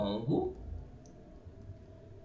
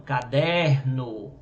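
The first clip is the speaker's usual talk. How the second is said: "caderno" with DER stretched out and louder; stress on DER; 5 dB louder, much slower than usual. Shown as a demonstration. Teacher showing where the tonic syllable falls, stressed DER in "caderno". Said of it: "a sílaba tônica dele é o der".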